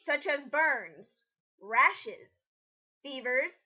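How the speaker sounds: usual speaking voice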